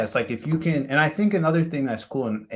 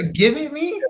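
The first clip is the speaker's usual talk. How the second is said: incredulous echo; 4 dB louder, slower than usual. Real, not acted. Friend echoes baffling British phrase.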